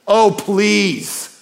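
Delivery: exasperated, high dismissive scoff; heavenly eye roll. Retold or imitated. God answering his accusers, dismissively exasperated. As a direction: roll your eyes through it. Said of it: "heavenly eye roll".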